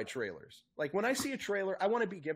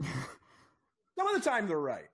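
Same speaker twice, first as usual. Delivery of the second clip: drawn-out admission; much slower than usual. Real, not acted. Self-deprecating drawn-out admission.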